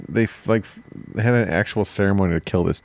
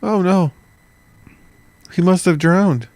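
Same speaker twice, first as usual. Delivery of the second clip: much higher mock innocence; 10 semitones higher than usual. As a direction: play the denial, hammed up. Mocking the Dutch claim.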